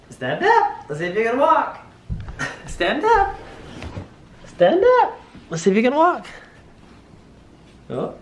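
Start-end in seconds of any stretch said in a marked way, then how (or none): 0.1-6.3 very high, bright rising encouragement. Real, not acted. Parent coaxing baby to stand and walk, high baby talk.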